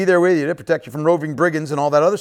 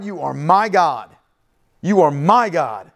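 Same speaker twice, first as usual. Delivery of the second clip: slow, with weight on "my"; measured declaration, "my" standing out; stress on my. Acted. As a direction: lean on "my" to claim ownership. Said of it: "Those are strong words of ownership".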